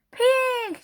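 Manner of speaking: very high, loud barked insult; furious single shout; angry shout. A narrator's reading. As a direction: spit the word at the pig. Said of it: "shouted Eugenia".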